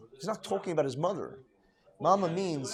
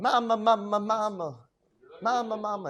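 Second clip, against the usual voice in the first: imitated crying; 6 semitones higher than usual. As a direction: imitate a rebbe crying out mid-reading. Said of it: "he starts crying and crying and crying".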